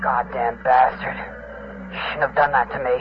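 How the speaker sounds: low, slow muttering threat; a low voice mutters threats; grumbling tough guy. Acted. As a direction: growl it under your breath. Low grumbling angry man.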